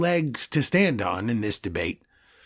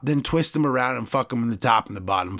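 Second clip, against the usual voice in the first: normal talking voice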